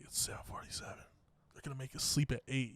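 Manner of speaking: hushed whisper, no voice at all. Retold or imitated. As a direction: breathe it secretly so the leader won't hear. Roommate whispers time at bedtime.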